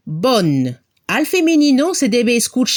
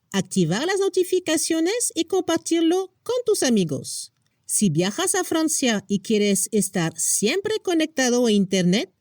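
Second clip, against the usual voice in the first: a softer channel sign-off and ad read; softer outro read; 6 dB quieter than usual. Acted. Teacher wrapping up the lesson to viewers, softer. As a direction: ease into a quiet outro.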